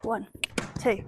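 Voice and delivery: ordinary talk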